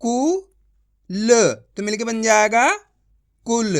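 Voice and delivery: कु then ल slowly joined into कुल; slow syllable-by-syllable blending. Shown as a demonstration. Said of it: "इतना ही खींच के पढ़ें".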